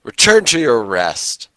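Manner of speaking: loud, high, drawn-out battle cry; commanding shout. Acted. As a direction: shout it slowly like a holy command. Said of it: "shout out".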